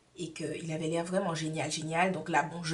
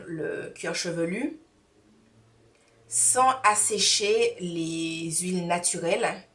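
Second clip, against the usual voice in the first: usual speaking voice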